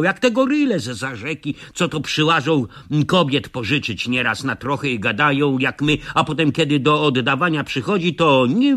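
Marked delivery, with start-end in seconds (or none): none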